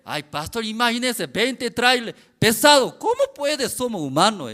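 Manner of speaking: high, loud pleading doubt; loud objection. Retold or imitated. Workers argue with pastor that they're only human, loudly. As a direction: plead it's impossible, loudly.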